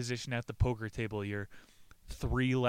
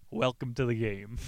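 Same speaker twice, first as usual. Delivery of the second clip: normal talking voice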